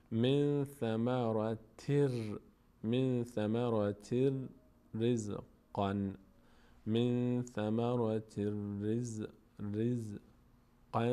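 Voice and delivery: very slow recitation of من ثمرتر رزقا, r doubled; measured Quranic reading with held r in ثمرتر; merging into ra. Shown as a demonstration. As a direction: stretch each syllable, joining ثمرت straight onto رزقا. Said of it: "میچسبونیم به را".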